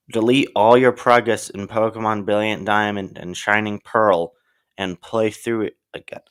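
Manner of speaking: usual speaking voice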